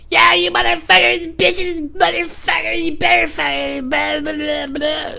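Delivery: high, loud, slow crazed yelling; mock the unhinged neighbor screaming; shrill put-on rant; imitated ranting neighbor.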